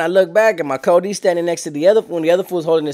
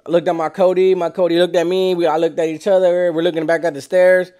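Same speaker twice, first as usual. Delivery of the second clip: normal talking voice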